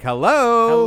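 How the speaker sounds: high, loud, sassy hello; hello with attitude. Shown as a demonstration. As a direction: toss it off with attitude. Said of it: "But without the attitude".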